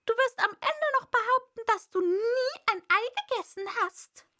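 high, loud, quick-fire bird tirade; indignant pigeon rant; scornful pigeon. A narrator's reading. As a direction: accuse her in an agitated pigeon voice.

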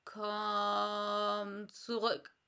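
slow, drawn-out caterpillar call; calling Raupe. Acted. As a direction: call her back slowly. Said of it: "rief ihr die Raupe nach". (langsamer Ruf der Raupe)

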